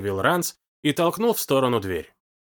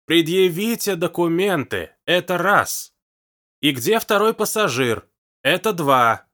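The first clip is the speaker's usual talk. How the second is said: loud, slow, higher shouted demand; shouting; 5 semitones higher, 4 dB louder, slower than usual. A narrator's reading. Hugo shouting in the deaf old woman's ear, loud. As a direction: yell each word into a deaf ear. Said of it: "прокричал".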